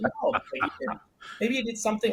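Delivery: voicing the sales rep. Sales rep nudges the founder toward a new drink, high airy voice. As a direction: pitch way up and whisper it like the rep.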